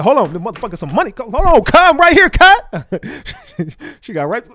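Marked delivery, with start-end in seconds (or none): 0.0-2.9 high, loud, rising into a squeal; mock frantic yell. Retelling a viewer scrambling for cash, high frantic yell. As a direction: imitate her panicking over the money, pitch climbing. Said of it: "She said".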